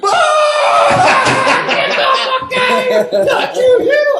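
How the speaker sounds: high, loud, laughing cry of disbelief; laughing shout. Retold or imitated. To the guy who knocked out Hattie's teeth, a loud laughing shout. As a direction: shriek it through laughter as the amused bikers. Bikers hear he hit Hattie.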